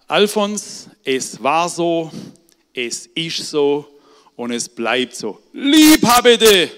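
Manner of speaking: laut, langsam und höher, mit tränenerstickter Stimme; gerührter alter Vater. Retold or imitated. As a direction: brich fast in Tränen aus, aber sag es laut. Vater gesteht unter Tränen. (alter Vater ruft seinem Sohn unter Tränen seine Liebe zu)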